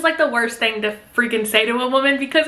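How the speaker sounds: ordinary talk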